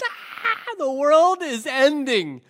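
high, hammed-up meltdown wail; mock despairing wail. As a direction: wail as if it's the end of the world, playfully. Voicing wailing kid in picture.

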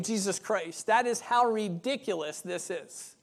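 ordinary talk, nothing marked

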